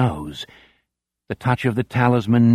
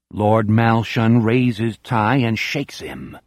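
usual speaking voice

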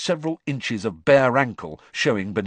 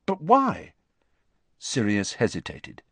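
ordinary delivery

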